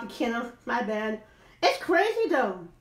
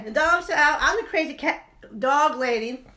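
normal talking voice

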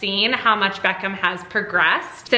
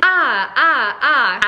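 imitating toddler's sounds; 3 semitones higher, 4 dB louder, much slower than usual. Mom reading how son imitated the K sound, mimicking him. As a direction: mimic a child trying out a sound. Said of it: "He would imitate first by saying".

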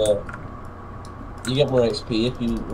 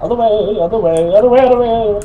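frantic calling; 8 semitones higher, 7 dB louder than usual. Real, not acted.

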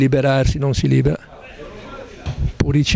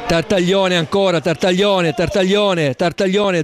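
normal talking voice

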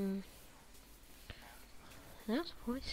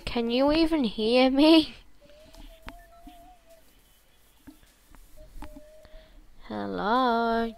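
loud calling out; shouting; 5 semitones higher, 12 dB louder than usual. Real, not acted. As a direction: shout into the mic. Calling out to other players.

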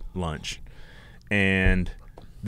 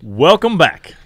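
higher, loud, drawn-out show welcome; announcer intro; 6 semitones higher, 9 dB louder, much slower than usual. Acted. Host restarting the podcast intro, loud announcer welcome. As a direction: open the show big like a radio host.

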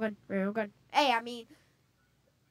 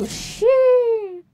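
high exclaimed sheesh; 11 semitones higher, 6 dB louder than usual. Real, not acted. Reacting to near backstab.

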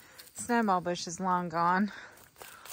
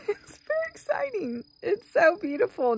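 giddy delight; 11 semitones higher than usual. Real, not acted. First bloom after five years.

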